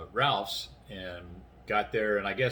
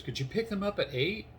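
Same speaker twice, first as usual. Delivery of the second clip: much higher, lightly imitated meek request; 11 semitones higher than usual. Store clerk asking about pickup, lightly imitated meek voice. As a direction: mimic a timid caller asking a favour. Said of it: "this little meek voice in there".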